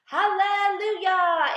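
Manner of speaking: loud, high, drawn-out cry of joy; jubilant shout. A narrator's reading. Aunt celebrates the giant peach, jubilant shout. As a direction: belt it out slowly syllable by syllable. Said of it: "Aunt Spiker shouted".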